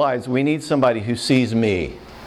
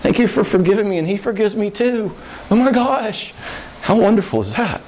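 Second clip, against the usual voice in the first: much higher, gushing with wonder; joyful amazement in heaven; 9 semitones higher than usual. Retold or imitated. Forgiven believer marveling to the one they hurt, high and gushing. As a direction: gasp it out, overwhelmed with relief and joy. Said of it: "We'll go".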